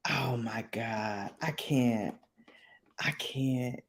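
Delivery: faint, whispered exasperation; low, soft, barely voiced; breathless aside. Real, not acted. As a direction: whisper it, overcome. Helpless reaction after the accent talk.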